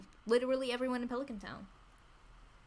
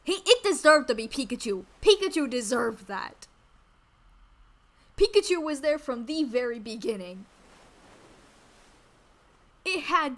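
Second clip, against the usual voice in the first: indignant rant; 7 dB louder than usual. Real, not acted. Indignant rant about Pikachu.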